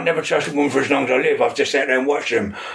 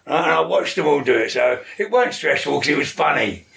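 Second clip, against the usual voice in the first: normal talking voice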